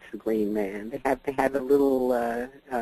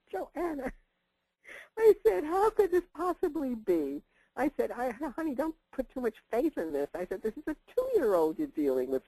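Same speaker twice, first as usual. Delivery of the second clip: quoted argument; 12 semitones higher than usual. Retold or imitated. Retold argument with daughter.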